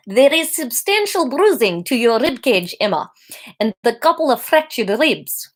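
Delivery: lightly put-on South African doctor; a doctor's accent slipping into South African; accidental South African accent. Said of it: "No, that is South African".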